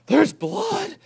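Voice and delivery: high, loud alarmed cry; horrified aunt, very high; gasped alarm. Acted. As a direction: gasp it out in horror. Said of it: "gasped Aunt Figgy".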